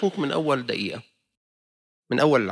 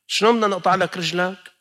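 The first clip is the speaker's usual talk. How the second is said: exasperated question; 7 semitones higher, 6 dB louder than usual. Retold or imitated. Surgeons asking how to amputate.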